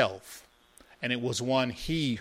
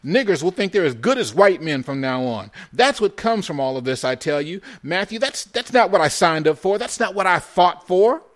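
loud, raised-pitch indignation; outraged rant; 7 semitones higher, 8 dB louder than usual. A narrator's reading. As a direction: protest hotly to your comrade. Jakey rants at Matthew, gun raised.

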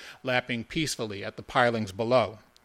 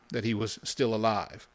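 ordinary delivery

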